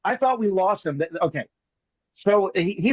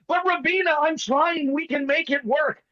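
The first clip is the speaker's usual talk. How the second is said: higher, pleading soap-opera lover; Tommy, caught out by Rubino, pleads in a higher, nervous voice; 7 semitones higher than usual. Retold or imitated. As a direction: beg nervously like a telenovela hero. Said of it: "Tommy starts nervously stroking his beard".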